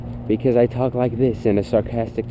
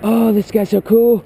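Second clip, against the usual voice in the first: high mock-gushing fan voice; gushing viewer; 10 semitones higher, 3 dB louder than usual. Imagined viewer praises his video, high mock gushing. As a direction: gush like an impressed fan. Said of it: "I'm gonna be like".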